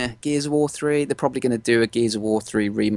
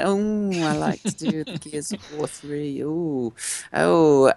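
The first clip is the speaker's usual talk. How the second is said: high camp voice, hammed up; a lightly camp, theatrical gush; camp extraordinary voice; 4 semitones higher, slower than usual. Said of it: "camp sort of, like, extraordinary".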